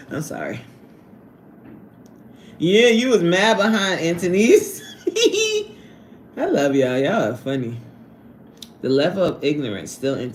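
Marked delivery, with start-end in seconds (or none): 4.9-5.7 a burst of high giggling; quick shrieky laugh. Real, not acted. Laughing at chat comment.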